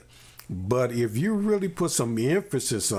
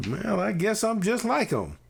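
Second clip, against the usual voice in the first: put-on child's voice pitched much higher, giving in; resigned child's realization; 9 semitones higher than usual. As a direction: let it land high as the kid finally concludes. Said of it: "the tone of voice, [name], that you just used".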